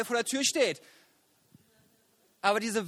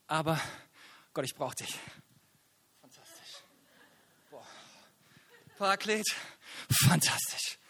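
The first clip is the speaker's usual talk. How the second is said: softer, hushed words to God; quiet prayer; 4 semitones lower, 4 dB quieter than usual. Real, not acted. Preacher praying quietly before the sermon, hushed. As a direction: pray under your breath.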